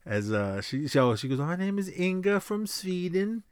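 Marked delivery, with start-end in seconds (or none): 1.4-3.4 high Swedish sing-song on "My name is Inga from Sweden"; put-on Swedish accent. Ophelia in disguise introducing herself on the train, Swedish accent. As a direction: introduce yourself as a fake Swede. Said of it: "Ophelia dressed up".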